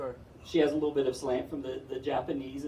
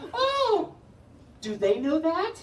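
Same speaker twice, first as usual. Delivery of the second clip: very high, loud horrified squeal; shrieking high alarm; horrified lady; 4 dB louder, slower than usual. Retold or imitated. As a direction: clutch your pearls, squeal it.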